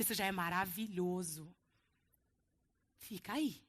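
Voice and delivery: low, soft, gentle comfort; tender reassurance. Acted. Jesus softly comforting the sick crowd, low and tender. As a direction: hold them close with your voice.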